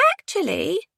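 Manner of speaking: put-on high child's musing; thoughtful. A narrator's reading. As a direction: muse aloud in a little kid's high voice. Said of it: "said Jimmy thoughtfully".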